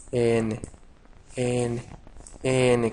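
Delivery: "N" said as a separate letter name; demonstrating pronunciation. Shown as a demonstration. Teacher shows students how the letter is pronounced, "N" repeated separately. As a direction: say "N" on its own, repeated with pauses. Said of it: "se pronuncia".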